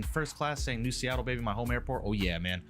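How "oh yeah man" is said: usual speaking voice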